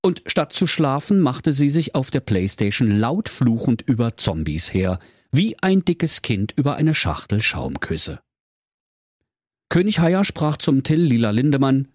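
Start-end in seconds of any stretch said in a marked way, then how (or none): none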